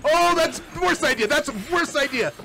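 high, loud alarmed protest; alarmed exclamation. Real, not acted. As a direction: protest against your own plan. Reacting to risky move.

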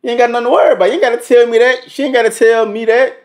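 high, loud, fast pretend warning to a rival; mock rival confrontation. Reactor voicing the other man in [name]'s song, high and loud. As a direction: play the jealous guy telling him to back off.

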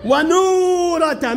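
ونورة with the oo stretched very long; long waw. Shown as a demonstration. Models word to show long waw. (ونورة مع مد الواو طويلًا)

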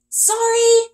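high, loud quick sorry. A narrator's reading. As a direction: raise the voice to be heard. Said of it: "raising my voice".

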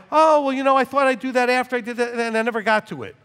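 high, loud flustered excuse; imitating a worker's lame excuse; mock excuse. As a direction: wriggle out of it, brushing it off. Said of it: "You're like".

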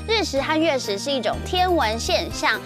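ordinary delivery, nothing marked

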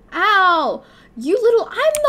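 loud, high yelp of irritation; annoyed outburst. Real, not acted. Player snapping at whoever just hit them, annoyed outburst. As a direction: cry out, stung and annoyed.

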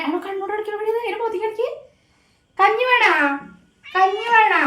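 high, loud coaxing call to the cat; pet talk to cat. Real, not acted. (പൂച്ചയോട് ഉച്ചത്തിൽ കൊഞ്ചൽ)

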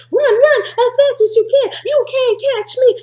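high, loud singsong taunt; the gingerbread man mocks everyone chasing him, in a high, loud singsong. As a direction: tease the chasers in a high cheeky voice.

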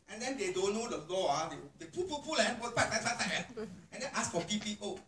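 ordinary talk

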